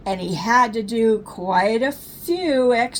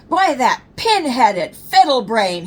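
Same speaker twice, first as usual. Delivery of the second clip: furious snarl; 3 semitones higher, 7 dB louder than usual. Acted. Papa after bumping the car, loud furious snarl. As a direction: let the anger burst out. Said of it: "he snarled".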